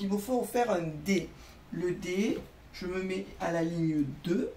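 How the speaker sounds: ordinary talk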